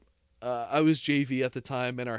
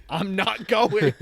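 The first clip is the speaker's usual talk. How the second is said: put-on higher, louder, slow, dug-in kid; stubborn kid refusal; 6 semitones higher, 6 dB louder, slower than usual. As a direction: plant your feet and refuse. Cam refuses again, firmly.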